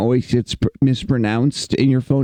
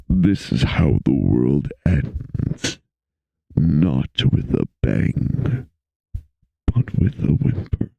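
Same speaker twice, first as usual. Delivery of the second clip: very low, slow announcer voice; 12 semitones lower than usual. Pretend. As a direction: drop way down and draw it out like an announcer. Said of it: "it's just announcer voice".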